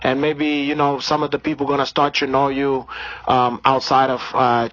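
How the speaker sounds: put-on Brazilian accent. Comedian holding a put-on Brazilian accent.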